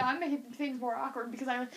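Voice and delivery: ordinary talk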